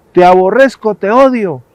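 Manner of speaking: put-on loud, high angry child's yell; furious child. Furious boy screams at the echo, loud and high. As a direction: spit the hate at the mountains. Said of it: "el niño se puso furioso".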